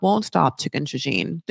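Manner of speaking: ordinary talk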